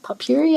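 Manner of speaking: "Potpourri" said high as pup-yoo-ree; "Potpourri" with the middle as "yoo"; old pronunciation. Retold or imitated. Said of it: "I think I used to say".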